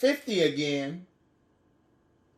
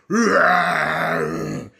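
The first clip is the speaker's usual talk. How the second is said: lion roar; 6 dB louder than usual. Acted. Lion leaps out in story.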